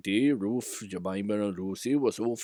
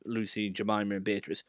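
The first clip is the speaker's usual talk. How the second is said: usual speaking voice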